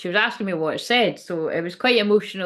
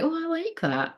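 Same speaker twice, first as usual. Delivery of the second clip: little niece voice; 10 semitones higher, 4 dB quieter than usual. Retold or imitated. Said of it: "she was like".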